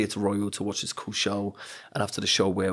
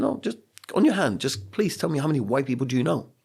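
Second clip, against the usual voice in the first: insistent teacher; 5 semitones higher than usual. Retold or imitated. Teacher presses pupil insistently.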